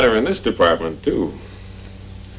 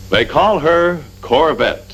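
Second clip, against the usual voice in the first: proud reveal; 6 semitones higher, slower than usual. Acted. Proud reveal of the car's name.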